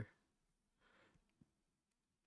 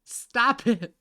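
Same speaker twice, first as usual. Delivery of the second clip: raised, high, loud scold; sharp scolding; 9 semitones higher, 12 dB louder than usual. Real, not acted. Streamer telling off the cats, sharp loud scolding. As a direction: snap it out loudly at a pet.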